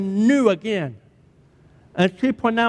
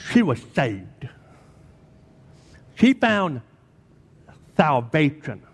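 normal talking voice

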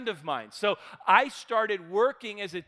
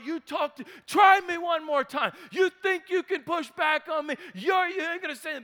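retold angry yelling; 8 semitones higher than usual. Linebacker yelling at the old therapist, hammed-up rage. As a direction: bark it like the enraged linebacker. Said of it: "screaming, yelling, cussing at Wayne".

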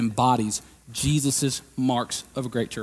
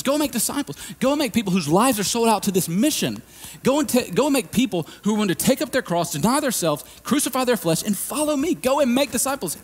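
voicing Jesus; 10 semitones higher than usual. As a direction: urge them on as Jesus sending disciples. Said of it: "He says".

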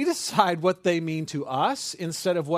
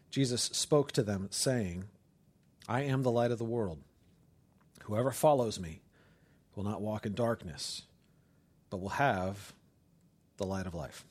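scripture reading; 7 semitones lower, 5 dB quieter than usual. Acted. Pastor reading scripture to congregation, hushed and reverent. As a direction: read the verse softly, with reverence.

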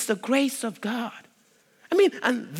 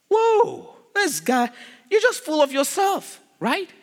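high, drawn "whoa" of a put-off listener; mock astonishment; 6 semitones higher than usual. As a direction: react as if taken aback by a braggart. Acted listener reacting to boasting.

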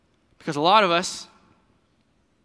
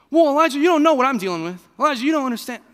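pitched up high and louder, protesting; exasperated complaint; 10 semitones higher, 7 dB louder, faster than usual. Retold or imitated. Protesting to Elijah, pitched up and exasperated. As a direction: throw up your hands at Elijah.

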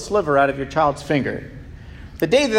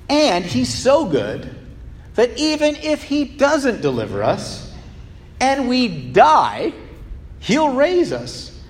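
earnest, slow; 5 semitones higher, slower than usual. Acted. Emphatic climax on resurrection.

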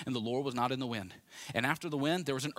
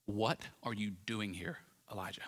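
a hint of calm, low-voiced deity, trailing quieter; God speaking; 4 semitones lower, 6 dB quieter than usual. God questions his prophet, lightly voiced, low and measured. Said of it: "this is now God speaking to his prophet".

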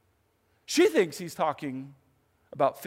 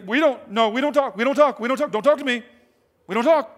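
loud, flustered, repeating herself; a mock-startled woman pushes him away; flustered refusal; 7 semitones higher, 9 dB louder than usual.

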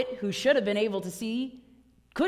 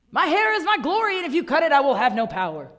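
higher, louder boastful quote; proud Samson; 4 semitones higher, 6 dB louder, faster than usual. Acted. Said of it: "you can cite Samson".

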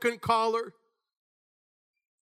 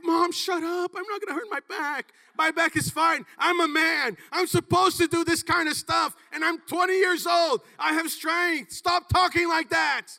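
high, loud, defiant shouting; yelling at mom; 9 semitones higher, 4 dB louder than usual. Retold or imitated. Son shouting down his worried mother, loud and defiant. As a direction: shout her off, indignant young man.

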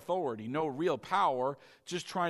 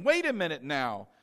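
triumphant preaching; 4 semitones higher, 10 dB louder than usual. Acted. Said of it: "That's not thinking".

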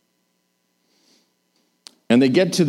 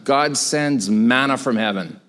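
usual speaking voice

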